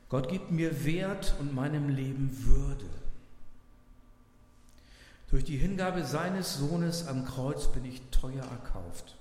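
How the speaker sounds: solemn quotation reading. Acted. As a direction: lean into each sentence as a read quotation. Pastor reads devotional quote.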